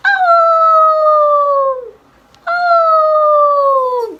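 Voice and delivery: high, loud wolf howl; drawn-out high howl, sliding slightly down. Shown as a demonstration. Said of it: "how the wolf does".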